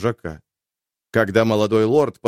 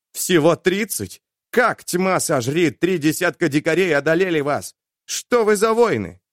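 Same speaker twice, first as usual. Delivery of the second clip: sudden anger; 7 semitones higher than usual. Acted. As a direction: snap at him in sudden rage. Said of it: "Внезапная злость проснулась".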